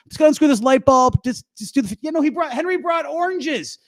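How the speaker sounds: high, flustered domestic panic; hammed-up scramble, calling out to a partner in the house; acted fluster, pitched high throughout; mock frantic. Acts frantic scramble for a bowl.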